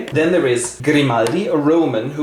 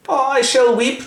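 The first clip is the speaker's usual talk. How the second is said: much higher hammed-up lament; a pitched-up mock tragic sigh; mock weeping sister; 11 semitones higher than usual. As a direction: wail it theatrically up high. Sister after the night.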